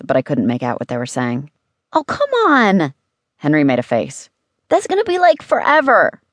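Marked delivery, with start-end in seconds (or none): 1.9-2.9 put-on high, drawn-out kid complaint; exasperated high-pitched whine; whining protest. A narrator's reading. Boy protests having to wait. 4.7-6.2 put-on high, exasperated child's voice; whining complaint. A narrator's reading. Boy complains about the wait.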